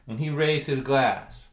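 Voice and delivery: ordinary delivery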